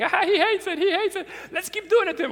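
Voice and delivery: put-on high, loud kids' voice through laughter; gleeful children teasing, giggling as they talk; laughing kids.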